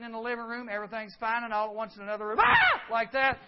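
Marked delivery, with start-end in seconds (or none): 2.4-2.8 sudden high piercing scream; imitated scream. Preacher imitating his sister-in-law's scream at a spider. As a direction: shriek like Joyce seeing the spider. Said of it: "And she screamed".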